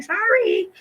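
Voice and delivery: high, sing-song fake apology; mock sorry receptionist. Clinic staff brushing her off, high chirpy sorry. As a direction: chirp it up high like an unbothered clerk. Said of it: "And they're like".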